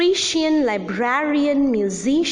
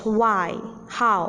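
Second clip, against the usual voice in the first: ordinary talk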